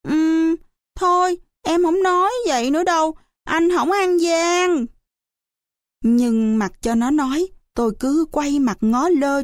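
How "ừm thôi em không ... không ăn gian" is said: put-on high-pitched child's appeasing plea; pleading. A narrator's reading. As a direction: beg like a contrite little kid. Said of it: "Đợi cho nó năn nỉ". (năn nỉ, giọng em nhỏ cao)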